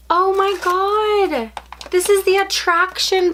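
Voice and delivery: high gasp and slow thrilled squeal; gasp, then squeal in delight; shrieking jackpot winner. Real, not acted. Unboxing a ride buggy toy.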